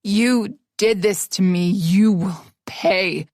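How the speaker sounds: very slow, heavy and menacing; seething rage. Acted. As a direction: grind out the threat. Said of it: "consumed with rage".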